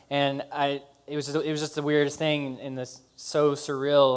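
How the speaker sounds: ordinary talk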